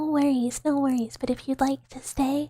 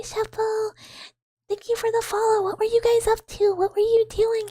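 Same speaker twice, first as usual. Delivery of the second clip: bright high-pitched hellos to raiders; high greeting; 8 semitones higher than usual. Real, not acted. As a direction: welcome the raid way up high.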